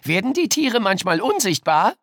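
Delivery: put-on high, loud child question; eager child voice. A narrator's reading. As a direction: ask like a keen pupil. Pupil asking in class.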